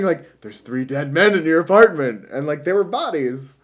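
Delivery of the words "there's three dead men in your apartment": lightly imitated movie line, a bit louder; Jim Belushi. Recalling what the actor kept saying, lightly imitated. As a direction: quote it louder with a slight change of voice. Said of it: "Jim Belushi kept saying".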